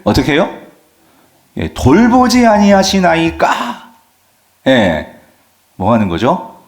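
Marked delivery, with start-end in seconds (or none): 1.7-3.9 high, slow, pleading reproach; protesting disciple crying out to his sleeping master; accusing disciples. Retold or imitated. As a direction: demand an answer from the teacher, aggrieved. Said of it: "따지는 거예요".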